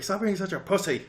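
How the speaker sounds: high, loud mock scolding; a hammed-up older brother telling him off; mock tough big brother. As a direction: bark it like a bullying big brother.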